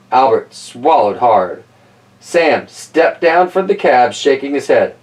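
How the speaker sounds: plain reading voice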